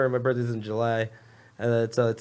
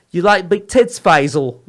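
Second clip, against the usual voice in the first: higher, louder mock Cockney purr; cockney come-on; 3 semitones higher, 7 dB louder, slower than usual. Retelling the wife's flirty line to the podcast host, in mock Cockney. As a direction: play the flirty Cockney wife, pushing it. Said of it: "the more cockney she gets".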